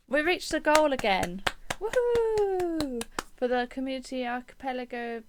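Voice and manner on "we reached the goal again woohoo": high, loud celebration; excited cheer. Real, not acted. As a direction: cheer the win. Celebrating stream goal.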